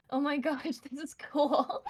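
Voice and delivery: excited. Retold or imitated. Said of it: "I'm like".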